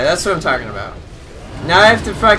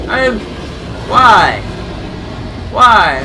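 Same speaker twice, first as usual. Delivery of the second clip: exasperated cry; 5 semitones higher, 7 dB louder, much slower than usual. Real, not acted. Frustrated after dying.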